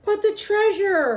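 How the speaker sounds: slow, loud, high wail; hollering. A narrator's reading. Braidbeard hollers about the treasure, slow and loud. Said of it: "hollered Braidbeard".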